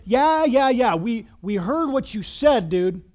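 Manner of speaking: rowdy, dismissive taunt; mocking. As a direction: heckle him like a mocking crowd. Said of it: "they're mocking him".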